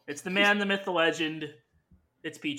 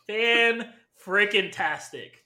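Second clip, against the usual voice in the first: drawn-out; much slower than usual. Acted. Drawn-out playful answer.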